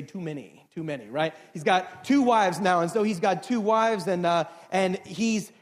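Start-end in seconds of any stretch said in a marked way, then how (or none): 0.0-1.1 low, soft mock aside; wry aside. Preacher joking to congregation about two wives, wry low aside. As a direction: drop it right down, under the talk.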